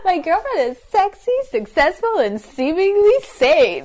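high, slow game show announcer; game show host. Acted. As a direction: present her like a dating show contestant. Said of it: "sounds like it's on a dating game show".

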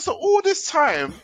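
high, slow laughing disbelief; realising he wasted money, laughing high; laughing dismay. Retold or imitated. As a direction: laugh through it, stretching it out high.

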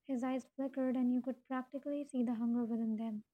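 usual speaking voice

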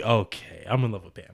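low, soft, resigned admission; mock confession. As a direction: confess it quietly, dropping the pitch. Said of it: "He's like".

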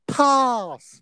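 high, mocking touchline shout of a dim coach. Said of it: "touchline shouts".

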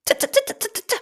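very high rapid pecking "t, t, t"; hammering bird beak. A narrator's reading. Bird pecking parasites from the elephant's skin, high rapid taps. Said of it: "hämmerte der Madenhacker".

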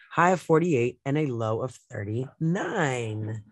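steady, measured newscaster delivery; radio news read. Acted. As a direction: read the headlines and forecast like a campus radio anchor. Reads the news and weather.

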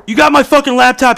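high, loud mock demand; hammed-up high-pitched confrontation; acted confrontation. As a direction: shout the accusation way up high. Re-enacts confronting housemate about laptop.

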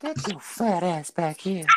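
put-on slow, high creepy old man; Herbert voice. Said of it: "that's a really good impression".

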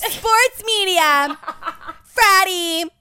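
slow, laughing imitation of friend's guess; laughing mock quote. Friend mocking her co-host's guess, laughing slow. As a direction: play her guess while cracking up. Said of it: "You're like".

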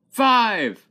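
loud sharp call of the number; shouting. Retold or imitated. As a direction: shout it out like the caller. Calling out the number.

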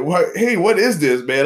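a high, loud, startled "what is this"; the cook, caught off guard by his own tasty mix; surprised cook. Retold or imitated. Cook amazed at his leftover experiment, high surprised exclamation.

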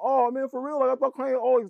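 mock surprised fan.

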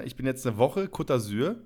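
usual speaking voice

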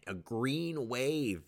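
slow, savoring each word; slow drawn-out slogan. Acted. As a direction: stretch it out like a slogan. Slogan said slowly.

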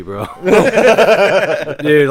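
ordinary talk, nothing marked

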